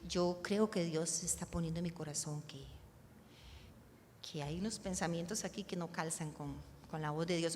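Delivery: low, soft, tentative sharing; voz normal, modesta. Shown as a demonstration. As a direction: offer it hesitantly, with pauses, leaving room for doubt. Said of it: "tratar de que la voz salga normal". (voz baja, suave y sin fingir)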